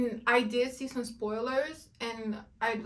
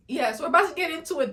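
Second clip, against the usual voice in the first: excited; 5 semitones higher, 4 dB louder, much faster than usual. Real, not acted. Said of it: "Let's get into it".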